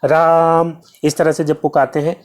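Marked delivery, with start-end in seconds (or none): 0.0-0.8 राम with the आ held very long and slow, as if calling far off; calling out a name. Shown as a demonstration. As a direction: call राम to someone in the distance, stretching the vowel. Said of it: "दूर वो है और हमें उसे पुकारना है तो हम बुलाते हैं".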